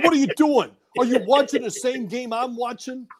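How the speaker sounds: imitating the coach mocking a player through laughter; Parcells needling. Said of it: "that Jersey guy that was always there with remarks".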